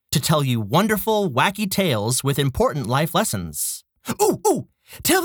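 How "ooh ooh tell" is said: excited. Acted. Excited puppet interrupting.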